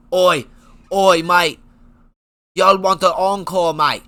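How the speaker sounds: loud mock Aussie "Oi, oi, mate"; hammed-up Australian accent on the mic. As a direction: Tom Aspinall grabbing the mic, in a loud faked Australian accent. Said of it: "That was more Australian".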